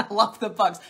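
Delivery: loud words through a laugh. Real, not acted. Answering Corey in chat, loud laughing.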